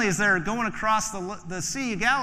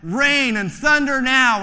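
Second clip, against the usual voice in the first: loud, high, slow mock decree; mock command; 7 semitones higher, 10 dB louder, slower than usual. Stranger in church commanding rain, loud mock decree. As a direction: boom it out like a wizard. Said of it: "stood up and said".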